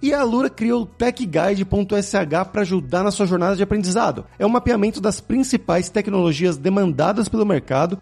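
lively, projected host sign-off and sponsor plug; podcast ad read. Acted. Podcast host plugs sponsor courses to listeners, upbeat ad read. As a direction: sell it with punchy, swooping announcer energy.

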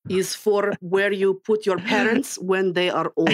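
slow put-on Russian accent; deadpan joke in mock Russian. Cohost teasing guest, punchline in slow mock Russian. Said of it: "in a Russian accent".

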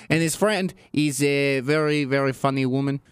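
a light put-on Russian accent; mock Russian accent. Lev describing the friend in mock Russian accent. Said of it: "my Russian accent".

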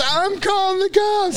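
very high, drawn-out mock threat; outraged neighbour. Spat-on lady threatens Mike, high outraged screech.

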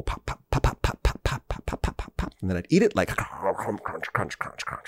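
soft unvoiced popping and crunching; whispered popcorn sound effects. Host making his own popcorn sounds, whispered. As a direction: mouth the pops and crunches softly. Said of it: "making all my own sound effects".

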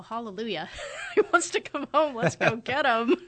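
gleeful laughter, relieved; laughing relief. Retold or imitated. Relieved son wants to come home.

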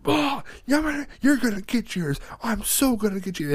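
high-pitched retold revenge threat; mock outrage. Frustrated brother threatening his sister over Uno, mock outrage. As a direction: imitate a worked-up kid vowing payback.

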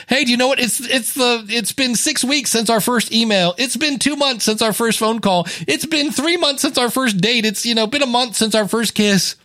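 high, sing-song counting of milestones; giddy lover. Retold or imitated. Smitten partner telling sweetheart about anniversaries, high and giddy.

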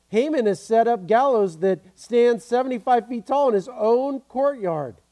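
loud, slowing on the last words; loud proclamation. Acted. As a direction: announce it to the king. Reading the eunuch's report.

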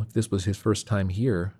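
ordinary delivery, nothing marked

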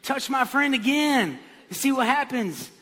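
hot-headed tough talk, hammed up; Peter. Disciple defending a friend with a sword, threatening. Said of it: "But Peter is that guy".

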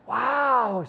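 loud, high, drawn-out wow; amazed wow. Retold or imitated. Keeley reacting to Higgins's made-up wisdom, hammed-up amazement. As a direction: imitate being blown away.

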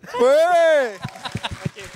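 high, loud hooray; cheering. Real, not acted. Host applauding the voice performance, high loud cheer. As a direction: call out a big drawn-out cheer.

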